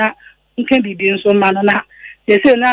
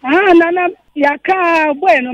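exasperated protest; 7 semitones higher than usual. Real, not acted. Caller protests loudly to host.

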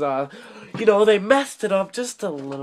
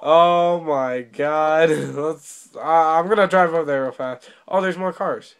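ordinary delivery

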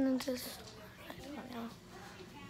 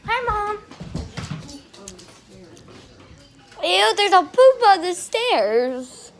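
yelling across the room; 7 semitones higher, 12 dB louder, slower than usual. Real, not acted. Calling to mom upstairs.

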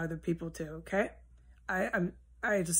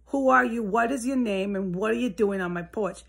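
shouting from the car; 2 semitones higher, 5 dB louder than usual. Retold or imitated. Calling from the car to a stranger on the porch, loud challenge. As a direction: demand his name, calling out loud through the window. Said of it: "I said from the car".

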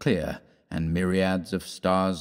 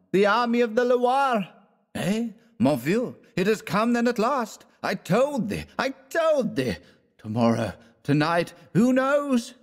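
much higher, jubilant voices; elated crowd cry; 14 semitones higher than usual. Acted. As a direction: cheer the long-awaited news. Crowd cheering the army.

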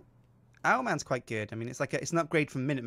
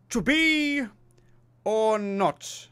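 grand theatrical high declamation, drawn out; epic Shakespearean actor; 7 semitones higher, much slower than usual. Pretend. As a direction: play Patrick Stewart on stage at the Apollo. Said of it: "some epic voice for this".

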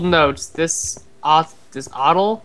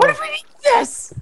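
squealed panic, slower; high panicked question. Real, not acted. Plane blows up.

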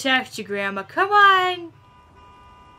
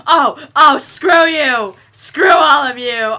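louder, hammed-up angry yelling; mock outrage; 3 semitones higher, 10 dB louder, slower than usual. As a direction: yell at them like an offended friend, half joking. Mock outrage at ignoring NPCs.

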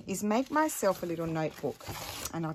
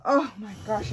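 usual speaking voice

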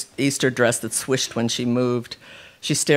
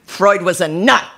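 louder, higher heated retort; 6 semitones higher, 6 dB louder than usual. A narrator's reading. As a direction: dismiss it with heat. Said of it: "Connie said with heat".

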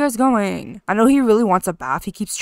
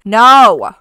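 sudden loud shout of protest; 13 dB louder than usual. Real, not acted. Player yelling no as the sim walks away. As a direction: shout to stop her leaving. Sim leaving to answer phone.

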